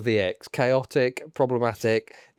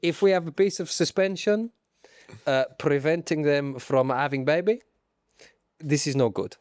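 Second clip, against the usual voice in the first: put-on comic Italian accent; Stefano Domenicali. Boss introducing himself to the public, Italian accent. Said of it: "As Italians".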